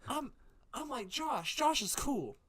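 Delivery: put-on a kid scolding his friend, building to a shout; mock high-voiced rant that ends in a yell. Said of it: "Timmy should grow up and go".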